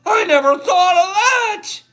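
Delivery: high, tearful and slowed, voice cracking with emotion; carried away, near tears. Real, not acted. Teacher moved by the spore text, high and tearful. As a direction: overcome as he reads aloud, almost crying. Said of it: "I'm getting carried away over here".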